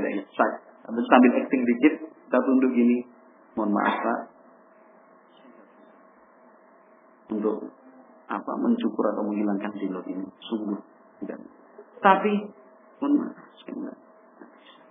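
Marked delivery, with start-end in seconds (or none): 3.6-14.8 soft, lowered, begging tone; a son humbly begging his father's pardon, softer and lower; humble pleading. Acted. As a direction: plead gently, fading to a breath. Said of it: "Intonasinya diturunkan, kita yang memelas".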